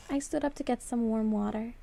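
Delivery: ordinary delivery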